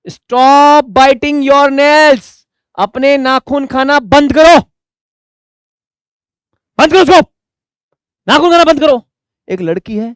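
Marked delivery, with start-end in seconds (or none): 0.1-2.5 loud, high, slow telling-off; scolding. Acted. Teacher scolds a girl in class, loud and high. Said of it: "उसके पास जाके क्या बोलता हूँ". 2.8-9.0 loud, high scolding. Acted. Teacher scolds a nail-biting girl in class, loud and high. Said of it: "उसके पास जाके क्या बोलता हूँ".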